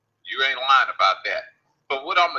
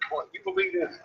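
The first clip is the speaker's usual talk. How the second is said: high, soft disbelief; can you believe this, pitched way up; incredulous; 7 dB quieter than usual. Real, not acted. Streamer tells viewers he got kicked, incredulous. Exasperated about Facebook kicking him.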